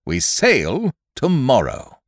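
slow hearty announcement. A narrator's reading. Squire answers boy triumphantly.

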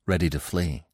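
plain reading voice

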